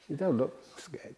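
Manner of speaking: usual speaking voice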